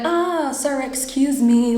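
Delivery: high, sweet mock politeness; polite, smiling approach. Approaching noisy upstairs neighbours to complain, smiling politeness acted out. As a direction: act out a nice apologetic opener with a smile. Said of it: "I go with a smile".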